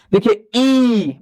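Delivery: slow drawn-out ई for the class; drawn-out vowel ई. Shown as a demonstration. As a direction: stretch the ई long so the tongue moves forward. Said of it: "बोलिए ई".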